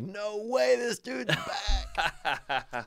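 high, soft incredulous murmur, trailing into a laugh; mock crowd disbelief. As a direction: play the crowd groaning that he's back yet again. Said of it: "it was like".

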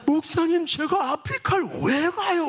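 high-pitched mock whine; Donghwan's whining protest. Young man pleads with his pastor, high mock whine. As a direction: plead upward in an imitated, exaggerated complaint. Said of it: "제가 똑같이 흉내낼게요".

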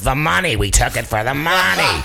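imitated crazy old star grabbing the mic; hammed-up interrupting outburst; Cloris Leachman. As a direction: blurt it out over everybody, mock-brazen. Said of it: "Cloris grabs the mic and goes".

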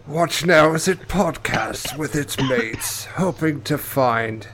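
slow, put-on documentary voice; nature documentary narrator. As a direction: narrate solemnly as if observing wildlife.

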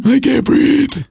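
put-on high, slow wheezing child; gasping daughter. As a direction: strain it out on a squeezed breath. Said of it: "my daughter's going".

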